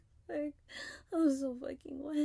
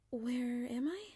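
normal talking voice

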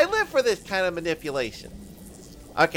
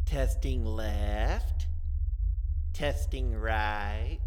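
low, slow sound check; slow testing; 8 semitones lower, 6 dB quieter, much slower than usual. Acted. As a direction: draw each word out. Tests headphones slowly.

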